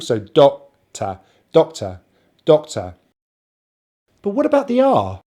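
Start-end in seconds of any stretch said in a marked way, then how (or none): 0.2-3.0 "doctor" with strong DOC, weak tuh; first-syllable stress. Shown as a demonstration. Teacher modelling the stress, first-syllable emphasis. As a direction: punch the start of "doctor", drop the end low. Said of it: "the stress on the first syllable".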